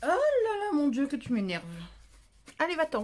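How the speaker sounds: a crafter at the end of her patience; exasperated outburst. Real, not acted. To the pestering cat, loud exasperated exclamation.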